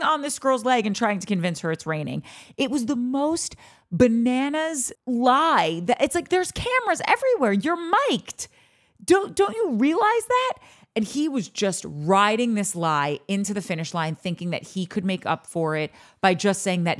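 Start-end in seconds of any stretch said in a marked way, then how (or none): 6.3-10.5 high-pitched, incredulous telling-off; exasperated scolding. Acted. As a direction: scold him for the obvious, voice way up. Scolding the absent man.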